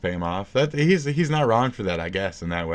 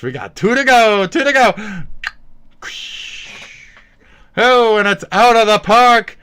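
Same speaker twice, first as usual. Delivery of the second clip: high, very loud play-by-play shout; mock baseball call; 14 semitones higher, 10 dB louder than usual. Streamer calls his own strikes like a ball game, shouted. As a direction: holler it like a home run call.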